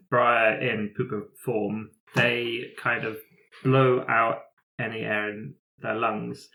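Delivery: ordinary talk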